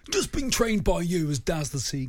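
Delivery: a light West Country seagull voice; voicing a seagull with a West Country lilt. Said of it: "my best West Country seagull".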